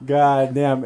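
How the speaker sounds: amused dismay; laughing exasperation. Retold or imitated. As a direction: groan at your buddy's slip through laughter. Exasperated at friend's blunder.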